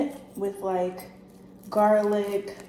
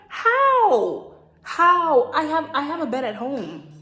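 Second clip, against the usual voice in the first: incredulous; 4 semitones higher, 5 dB louder, slower than usual. Retold or imitated. Retorts incredulously to guy.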